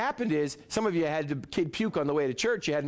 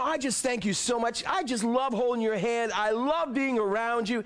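higher, gushing praise like to a sweetheart; mock sweet-talk praise; 7 semitones higher than usual. Preacher compares praise to courting, higher gushing voice. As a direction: croon it to God like a lover. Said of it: "Now, when you praise".

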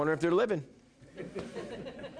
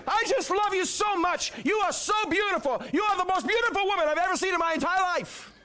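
much higher shouted gushing, fast at the end; hammed-up lover; 3 dB louder than usual. Preacher pretends mother is girlfriend, hammed-up loud flattery. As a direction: overdo the sweet talk, laughing. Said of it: "and can you imagine".

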